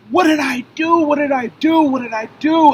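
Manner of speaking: high, loud desperate cries; frightened man yelling at police; panicked pleading. Retold or imitated. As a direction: plead over and over in terror. Panicked repeated pleas.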